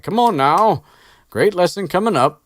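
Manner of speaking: high, loud booming giant voice; hearty Hagrid. Retold or imitated. Podcaster voicing Hagrid to his class, loud and hearty. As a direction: boom it out like a jolly giant.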